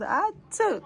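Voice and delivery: imitating the child. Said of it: "she goes".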